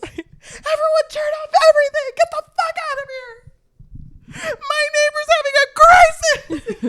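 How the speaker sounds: high, loud, laughing shout; mock shout. Friend hams up the hero ordering the party off, laughing shout. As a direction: holler it through laughter like a hammed-up host.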